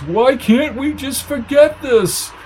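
high, slow pleading wail; quivering yell. A narrator's reading. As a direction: beg in a quivering voice. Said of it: "yelled Lark in a quivering voice".

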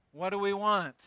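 ordinary talk

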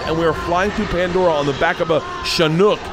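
ordinary talk, nothing marked

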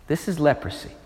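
solemn hush. Real, not acted. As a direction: let the weight sink in. Solemn emphasis.

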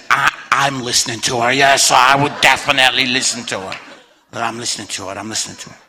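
low, deadpan comic aside; lightly voiced God, deep and knowing; wry voice of God. God siding with the angry wife, low and wry. God's wry advice, acted out.

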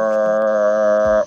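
long low "Arr" drill; drawn-out r sound. Shown as a demonstration. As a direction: growl out "Arr" slowly. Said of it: "sit around in a circle and go".

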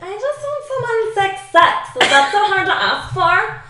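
very high, loud, drawn-out mock whine; exaggerated complaining-girl imitation; mocking whiny friend. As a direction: whine it slowly up high, making fun of her. Mimics tall friends whining.

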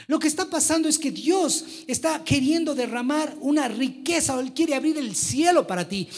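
high, loud preaching; fervent preaching. Acted. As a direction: preach it with full force. Preaching at full force.

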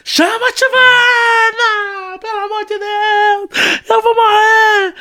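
very high, slow, tearful mock wail; hammed-up dying screams, every phrase stretched; retold desperate screaming. Injured caller begging brother to fetch aunt, long wailing screams. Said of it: "Eu gritava".

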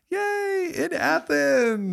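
high, slow drawn-out cheer; mock cheer. Host introducing guests from Athens, high drawn-out mock cheer. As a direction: cheer it way up high, dragging out the words.

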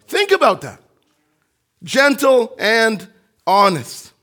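loud, high preacher building to a peak; fervent sermon climax; preaching at full force. Acted. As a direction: drive it home to the congregation.

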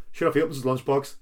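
usual speaking voice

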